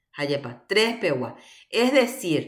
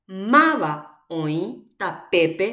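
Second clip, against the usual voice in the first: drawn-out teacher's reading with pauses between words; slow demonstration reading; much slower than usual. Shown as a demonstration. Teacher reads a sentence for students to place accents, slow demonstration reading. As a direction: read MAVA OĨ TAPEPE slowly, word by word. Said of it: "Van a leer la oración".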